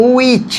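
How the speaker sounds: উইচ with a clean w start, no h; no h sound. Shown as a demonstration. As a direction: model উইচ without the h. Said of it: "হ আসবে না". (হ ছাড়া উইচ)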